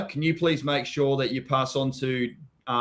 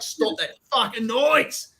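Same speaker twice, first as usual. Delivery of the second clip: slow, higher, exasperated snap; mock irritated; 8 semitones higher, much slower than usual. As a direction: drag it out, fed up with the noise. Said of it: "and I'm like".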